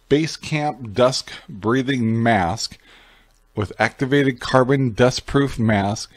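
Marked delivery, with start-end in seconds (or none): none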